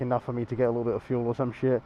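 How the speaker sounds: usual speaking voice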